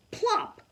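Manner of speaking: high popping plop; the bird landing on her head. A narrator's reading. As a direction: pop it out high.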